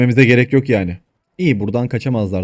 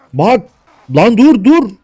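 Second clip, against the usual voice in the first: much higher and louder yell; frantic shout; 10 semitones higher, 9 dB louder, slower than usual. Real, not acted. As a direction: yell stop at the troops. Yelling at game units. (yüksek sesle bağırış)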